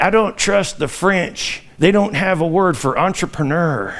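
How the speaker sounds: soft, slow Texas southern drawl as the president; a slow, quiet Texas drawl, like the former president; George W. Bush Texas drawl. Retold or imitated. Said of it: "That's the southern accent from Texas".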